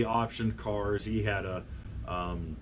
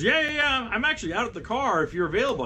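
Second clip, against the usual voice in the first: much higher, quick and keen, a retold girl on the phone; eager young woman; 11 semitones higher than usual. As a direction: chirp it back like she's waiting by the car. Girl answers, voiced in story.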